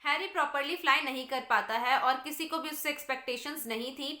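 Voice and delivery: normal talking voice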